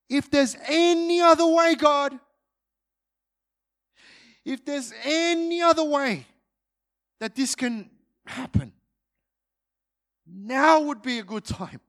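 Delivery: high, pleading cry to God. Retold or imitated. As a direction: beg God for another way, voice lifted high. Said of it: "he's crying out to God".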